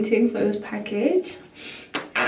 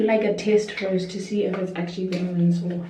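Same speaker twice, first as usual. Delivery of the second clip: ordinary delivery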